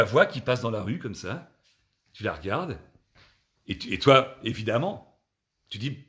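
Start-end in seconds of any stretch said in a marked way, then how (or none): none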